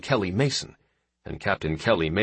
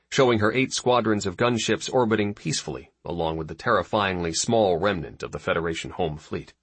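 ordinary delivery

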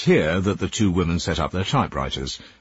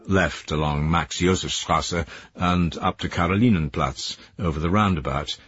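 plain reading voice

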